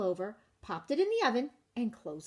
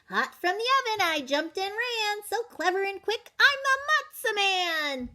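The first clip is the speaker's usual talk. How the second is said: high, bouncy boastful chant; sing-song brag as the runaway cracker; cocky matzah man; 10 semitones higher, 4 dB louder than usual. Acted. Matzah man taunting the baker in a high boastful sing-song.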